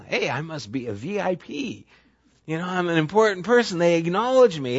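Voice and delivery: lightly voiced pleased inner voice; mock self-important thought. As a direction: play the flattered VIP a little. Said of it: "it makes you feel good".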